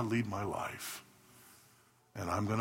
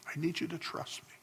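softer and higher, gently pleading; tender plea; 7 semitones higher, 6 dB quieter than usual. Acted. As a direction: ease it down to a soft, close plea. Preacher voicing God's plea.

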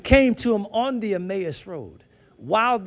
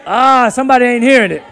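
ordinary delivery

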